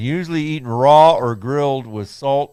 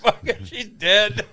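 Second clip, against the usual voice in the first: laughing mock shrug; 9 semitones higher, slower than usual. Mock careless staff quote.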